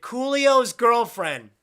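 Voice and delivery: slow, loud teasing shout; teasing call. Real, not acted. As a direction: call out playfully to tease her.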